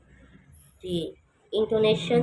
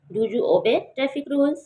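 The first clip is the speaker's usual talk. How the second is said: slow yes-no question with a rising finish; rising tune; 5 semitones higher, 3 dB louder, much slower than usual. Shown as a demonstration. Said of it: "इस पे हमने आवाज़ को rise किया है".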